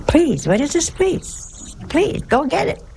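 high-pitched hammed-up sibling retort, rising to a squeal; mock Italian family scolding. Preacher imitating his family at dinner, hammed-up high scolding. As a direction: mimic a bossy Italian relative mocking the polite ask. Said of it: "That's Italian".

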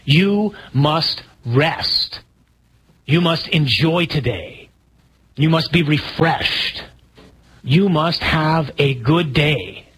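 slow, each word hammered home; emphatic command. Shown as a demonstration. Preacher urging his flock to rest, slow and firm. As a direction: spell out the command slowly, stressing you. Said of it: "That's the command".

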